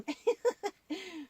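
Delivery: high, bubbly giggle; puppet giggle. Acted. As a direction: giggle at the silly picture. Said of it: "What's so funny?".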